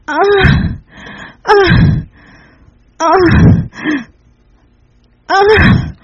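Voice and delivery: high sharp cries forced through a gag; short high muffled yelps; gagged cries. Acted. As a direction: cry out high with the mouth gagged. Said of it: "Grité a través de la mordaza".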